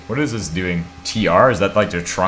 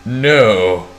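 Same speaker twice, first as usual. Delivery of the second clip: loud dismayed no; 2 semitones higher, 7 dB louder than usual. Real, not acted. Spots an error.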